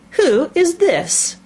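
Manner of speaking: slow, high, imperious demand; severe. A narrator's reading. Queen questions the Knave about Alice, severely. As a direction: draw it out severely, pitched high. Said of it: "the Queen said severely".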